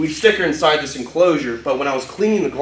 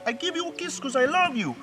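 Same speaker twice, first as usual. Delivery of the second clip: talking to a dog; 11 semitones higher, 8 dB quieter than usual. Real, not acted. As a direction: coo at the puppy. Baby talk to the dog.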